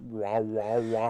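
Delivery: babyish mocking wah sounds; mock her silly camera noises; lightly imitating a wah wah taunt. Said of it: "She goes".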